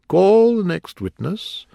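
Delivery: high, loud, slow summons; calling out. A narrator's reading. King moving the trial on, calling out. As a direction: sing out the order to the court. King calls court witness.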